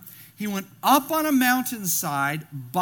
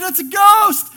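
high, loud frightened shout; terrified cry; 12 semitones higher, 15 dB louder than usual. A narrator's reading. Disciples shouting in fear at a ghost. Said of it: "they were terrified".

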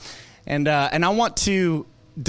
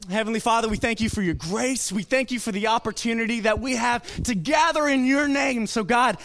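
higher, earnest prayer voice; 6 semitones higher than usual. Acted. Pastor praying over the congregation, earnest and lifted. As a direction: lift the prayer up to God.

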